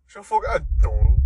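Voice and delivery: lightly hammed-up English accent. Gushing over a cute photo, in a mock English accent. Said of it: "Straight up with a fucking English accent".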